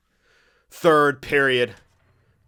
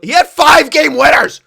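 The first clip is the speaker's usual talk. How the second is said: much higher, loud exclamation; hyped; 15 dB louder than usual. Real, not acted. Gamer hypes a player's playoff stats, loud and high. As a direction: burst out with the stat.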